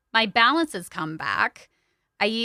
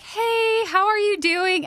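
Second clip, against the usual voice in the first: high bright sing-song hello; imitating the nurse's cheery greeting; 10 semitones higher, slower than usual. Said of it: "the nurse would come in and be like".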